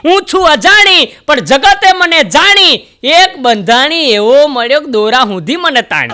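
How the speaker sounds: slow, high, loud recital of the rhyme; bidi's poetic boast. Acted. The bidi boasts to the audience in verse, slow and high. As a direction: declaim it like a proud verse. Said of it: "બીડી એમ કહેતી હતી".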